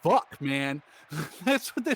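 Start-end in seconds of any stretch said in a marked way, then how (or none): 0.0-0.8 mock exasperated teacher. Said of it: "And he goes".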